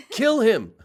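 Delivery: high, loud comic yell; mock outraged shout. Jersey friends turning on the proper talker, mock shout. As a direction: shout it as a joke mob order.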